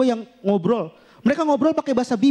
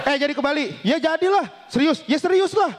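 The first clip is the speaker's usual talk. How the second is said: raised, forceful mock conversation; high-toned Bima chat; 4 semitones higher than usual. Two Bima friends planning Bali, mock high-toned talk. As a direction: chat as if it were a quarrel. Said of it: "kalau ngobrol tuh nadanya tinggi".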